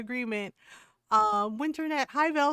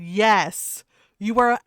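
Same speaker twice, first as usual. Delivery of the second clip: loud, emphatic group verdict; emphatic yes; 9 dB louder, slower than usual. Retold or imitated. Podcast hosts loudly tell a husband he's the asshole. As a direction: declare it loud like three judges at once. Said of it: "all three of them in unison said".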